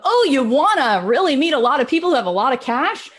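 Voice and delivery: mock the idea ironically; mock sales pitch. People pitching songwriters as rich, mocking put-on voice.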